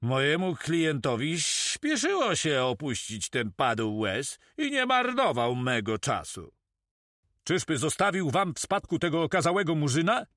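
lively storybook pirate; pirate in the story. Acted.

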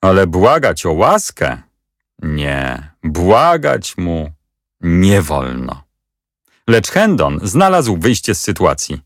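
ordinary delivery

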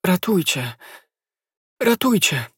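plain reading voice

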